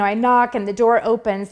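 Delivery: ordinary delivery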